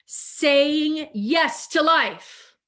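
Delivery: "Saying yes to life" drawn out slowly, each word weighted; slow emphatic affirmation. Shown as a demonstration. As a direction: let "to" ring out slow and full. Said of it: "That's what I was gonna say before that I held off on".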